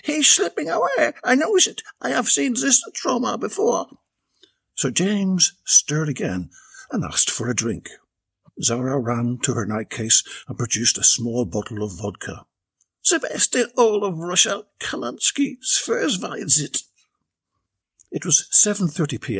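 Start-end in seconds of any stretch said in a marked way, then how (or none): 0.0-4.0 much higher, dramatic aristocratic lady; a panicked noblewoman at a bedside; the Countess. A narrator's reading. Countess voiced in story. 13.1-16.8 higher, lightly voiced Russian girlfriend; a hint of a Russian woman; Zara. A narrator's reading. Zara offers Russian vodka.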